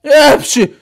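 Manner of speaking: high, loud sneeze. Acted. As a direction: burst out in a squeaky sneeze. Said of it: "hepsi hapşırıyor". (hapşırma)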